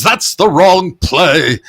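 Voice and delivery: high, loud, slow put-down; dry retort. Retold or imitated. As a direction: drag out a scornful correction. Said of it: "Lawton says".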